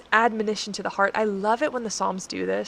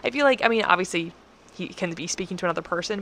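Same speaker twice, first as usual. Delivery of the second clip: ordinary talk, nothing marked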